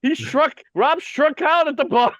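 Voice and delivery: loud, high-pitched mock announcement; gleeful teasing. Real, not acted. Host teasing Rob about the bar story, gleeful. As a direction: crow it out, pitched right up.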